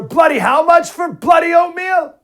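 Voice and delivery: put-on very high, loud, slow British accent yell; over-the-top British grocery rant; mock British shout. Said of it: "I'll keep the British accent to Jesse".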